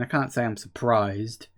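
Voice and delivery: usual speaking voice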